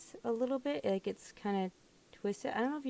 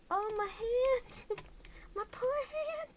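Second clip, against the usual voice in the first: whimpering cry. Real, not acted. Pained cry over hurt hand.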